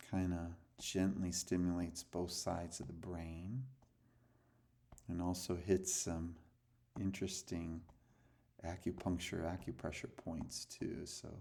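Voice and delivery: calm breathing coach voice; guided meditation. Acted. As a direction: ease them into letting go. Leads a breathing exercise.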